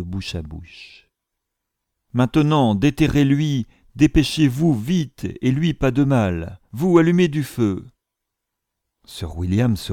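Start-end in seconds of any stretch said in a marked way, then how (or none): 2.1-7.9 higher, louder urgent orders; commanding servant. A narrator's reading. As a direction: rush the men into digging. Secundra urging.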